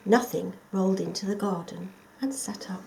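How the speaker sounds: ordinary delivery, nothing marked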